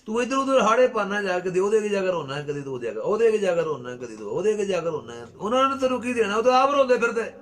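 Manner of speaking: high, loud sermon rebuke; surging preacher's cry, dipping then soaring; fiery preaching. Real, not acted. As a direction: scold the congregation at full force. Preaching at full force.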